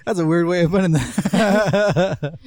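loud, high, laughing protest. Real, not acted. To a friend who worded it oddly, laughing. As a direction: burst out laughing and talk through it.

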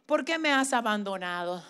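hushed lament; anguished cry of Jesus. Retold or imitated. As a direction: voice Christ's forsaken cry quietly. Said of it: "la próxima palabra fue".

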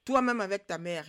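hurled back loudly, brash; loud retort. A narrator's reading. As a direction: fire the insult straight back, loud. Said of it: "riposta le Nkondi".

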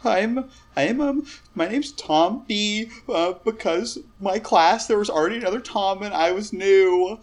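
high, hesitant character voice; timid, nervous Tom. Acted. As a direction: introduce yourself as a high-voiced nervous wreck.

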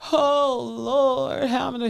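playing an anxious worrier, lightly; mock fretting. Mock fretting for a man weighing the bill. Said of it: "he ain't thinking about".

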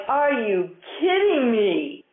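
incredulous. Acted. Incredulous reaction to tiny gains.

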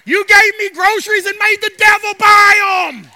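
high, loud, slow triumphant praise; triumphant shout. Retold or imitated. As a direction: crow it back at the atheist. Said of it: "She said".

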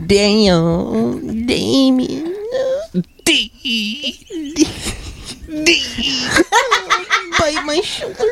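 high whiny squealing voice; mock high squeal. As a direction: squeal it like a fake sob. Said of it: "Except it's more like".

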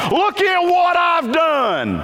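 high, slow, self-satisfied mock boast; smug boast. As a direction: brag like a proud child showing off. Mocks the Corinthians' boasting.

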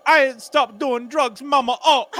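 a son defying his mother, in a mock Jamaican accent. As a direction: answer your mother in a hammed-up Jamaican accent. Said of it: "He's like".

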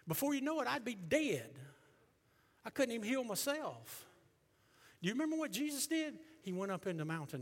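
high, loud pulpit shout; full-force preaching. Real, not acted. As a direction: cry it out to the whole congregation.

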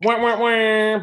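high whiny wah wah; mocking whine. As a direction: whine like a crybaby.

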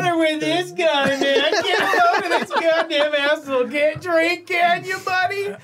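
high, laughing mock scolding; ribbing a buddy through laughter; laughing teasing. Real, not acted. Teasing friend who spat drink.

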